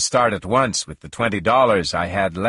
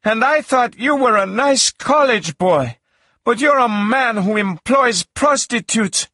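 high-pitched screaming reproach in character; screamed accusation; 13 semitones higher, 4 dB louder than usual. A narrator's reading. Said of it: "she screamed".

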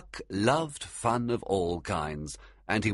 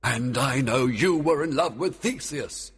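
higher, louder king voice; a king hitting back; Oberon; 7 semitones higher, 5 dB louder than usual. A narrator's reading. Oberon countering Titania's accusation, louder king voice. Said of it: "Oberon told her".

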